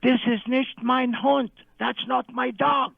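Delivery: hammed-up indignant shriek; retold scream. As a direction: imitate an upset woman yelling. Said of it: "she screamed".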